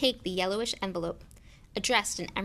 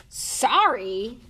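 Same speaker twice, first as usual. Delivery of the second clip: loud, higher bark of disbelief; indignant bark; 4 semitones higher, 9 dB louder than usual. A narrator's reading. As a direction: bark it back in outrage. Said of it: "barked Hagrid".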